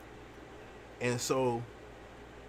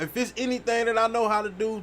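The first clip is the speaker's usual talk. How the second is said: normal talking voice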